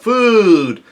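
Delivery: high, loud drawn-out cry; mock yell. Reader voices Luffy yelling out for food, yelling. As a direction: holler it like a hungry cartoon hero. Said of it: "Luffy yells out".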